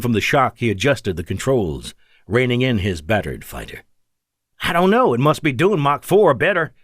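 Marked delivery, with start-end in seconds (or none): 4.6-6.7 high-pitched, rattled reply; rattled pilot, voice raised in pitch; faltering alarm. A narrator's reading. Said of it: "Victor faltered as the object broke right".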